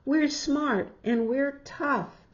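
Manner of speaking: rally the gang to dig; rallying call. Acted. Peter rallying friends to dig, loud and bold. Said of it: "Peter rallies friends".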